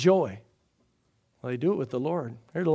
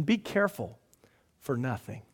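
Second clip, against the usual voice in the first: soft scripture reading; 4 semitones lower, 8 dB quieter than usual. Acted. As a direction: let the verse fall gently. Scripture read softly.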